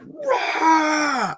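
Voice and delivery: high startled roar with a laugh; mock roar. Man telling a guest how he roared at a noise, high laughing roar. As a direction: roar back at the thing in the brush. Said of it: "I'm like".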